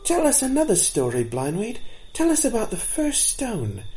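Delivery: put-on a young fawn calling out from the back; eager fawn shouting. A narrator's reading. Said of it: "the young fawn at the back shouted out".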